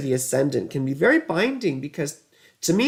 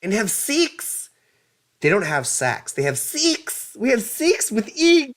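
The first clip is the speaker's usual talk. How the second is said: high, mock Kiwi accent; mock New Zealand; 9 semitones higher, slower than usual. Teasing Larissa about New Zealand, hammed-up Kiwi accent. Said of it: "It's so funny".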